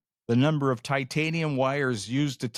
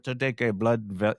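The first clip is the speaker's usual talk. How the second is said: put-on French Canadian accent. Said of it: "excuse my best, uh, French Canadian accent".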